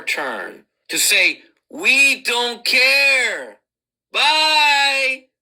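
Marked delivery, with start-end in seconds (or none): 1.7-5.3 drawn-out, mocking kiss-off; taunting, very slow dismissal; dismissive send-off. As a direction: stretch it out slowly to brush them off. Acted send-off, our turn to say.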